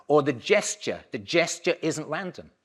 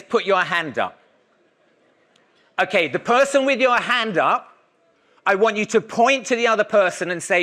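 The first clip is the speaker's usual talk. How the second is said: raised, higher voice calling instructions to the class; projecting to a room; 6 semitones higher, 6 dB louder than usual. Acted. Lecturer calling out instructions to the audience, projected and raised. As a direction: project instructions across a hall.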